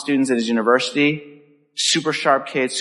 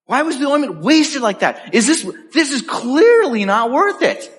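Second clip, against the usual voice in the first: indignant; 11 semitones higher than usual. A narrator's reading. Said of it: "said to themselves indignantly".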